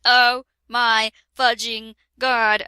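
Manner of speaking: usual speaking voice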